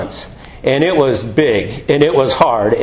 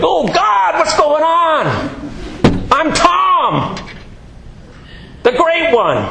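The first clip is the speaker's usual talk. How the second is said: high shouted plea of the humbled boaster; mock panicked cry; 12 semitones higher, slower than usual. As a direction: cry out in hammed-up dismay. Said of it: "And I was like".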